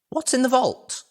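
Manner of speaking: high, put-on character voice asking; a lightly voiced pony asking about the vault; questioning voice. Character asks what the vault holds, high voice.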